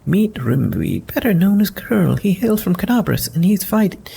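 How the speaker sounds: a confident lady knight teasing; voiced Sela, wry; paladin voice. Sela introduces Curl.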